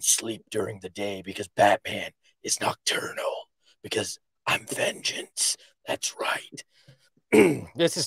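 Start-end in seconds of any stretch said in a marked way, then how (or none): 1.5-6.6 low, hushed vigilante voice; low Batman ad read. Acted. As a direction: do a weak Batman, low and under the breath. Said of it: "Wait for Batman".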